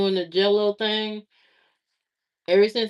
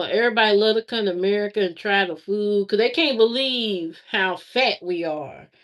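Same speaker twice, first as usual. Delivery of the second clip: normal talking voice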